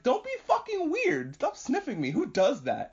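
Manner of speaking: high-pitched mock outrage; indignant rebuke. Deer scolding the wolf who is sniffing him, mock indignant.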